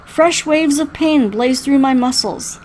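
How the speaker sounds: ordinary delivery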